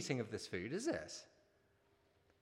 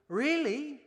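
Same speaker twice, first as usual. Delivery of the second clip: put-on high amazed little boy; surprised child; 12 semitones higher, 5 dB louder than usual. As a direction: squeak it out in disbelief. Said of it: "asked the little boy".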